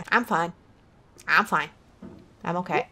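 low, soft, short reassurances; subdued self-calming. Real, not acted. Gamer composing self after rant, subdued self-calming. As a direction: drop down low and quiet.

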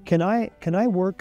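ordinary delivery

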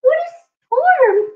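very high, chirpy little bird voice; twittering wren. Acted. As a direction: squeak it up high like a tiny bird. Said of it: "twitters Wren".

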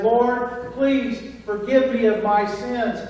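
earnest, slowing prayer voice; model prayer. Shown as a demonstration. Preacher models a simple prayer for the congregation, sincere and slow. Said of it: "just as simple as".

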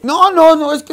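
mock the cornered valet squeaking; panicked stammer. Valet caught, stammering excuses. (el valet acorralado balbucea excusas con voz muy aguda)

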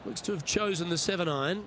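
hushed, measured golf TV commentary; golf commentator. Acted. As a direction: call the play like a TV golf broadcaster.

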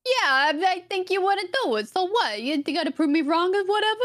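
high-pitched, goading New Yorker bit; mock New York accent. Friend daring a gamer to make a table, mock New York accent. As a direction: dare them in a put-on New York accent. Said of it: "as a New Yorker, I feel offended".

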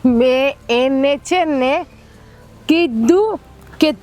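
very slow, drawn-out words; overly slow talk to tease the husband; exaggeratedly slow. Shown as a demonstration. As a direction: crawl through it to mock the order. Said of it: "ધીમે ધીમે બોલ".

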